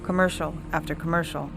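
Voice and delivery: ordinary delivery, nothing marked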